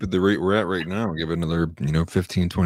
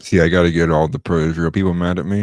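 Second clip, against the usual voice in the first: loud; 9 dB louder than usual. Real, not acted. Joking loud declaration.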